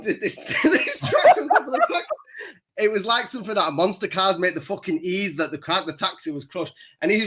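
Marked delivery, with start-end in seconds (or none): 0.6-2.1 words squeezed out through high laughter; can't get it out for laughing. Real, not acted. Telling his mate about the taxi, laughing hard.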